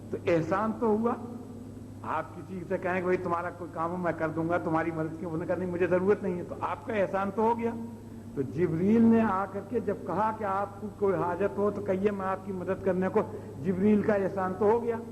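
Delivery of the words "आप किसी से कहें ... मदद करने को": ordinary talk, nothing marked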